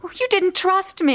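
upset and accusing; hurt accusation. Acted. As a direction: throw the blame back at him. Fiancée accuses him in quarrel.

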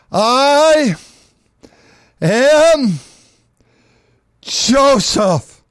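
each word held apart, ringing out; dramatic reveal. Acted. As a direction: announce it word by word with awe. Joseph reveals himself to brothers.

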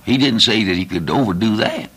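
ordinary talk

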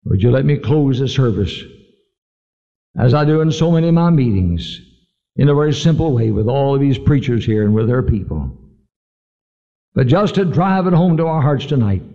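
ordinary delivery

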